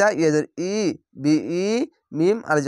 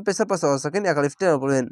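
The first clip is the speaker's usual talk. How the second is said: ordinary talk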